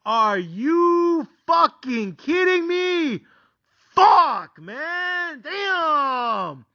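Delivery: high, drawn-out exasperated shout; frustrated yell. Retold or imitated. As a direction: wail it slowly in disbelief. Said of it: "Now I'm motherfucking frustrated".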